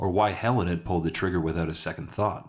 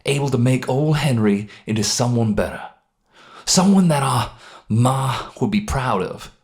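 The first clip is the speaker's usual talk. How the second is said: louder, upbeat selling voice; persuasive Henry; 4 semitones higher, 7 dB louder than usual. Acted. Henry selling Ray on the champagne life, eager and loud. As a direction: dangle the dream in front of him.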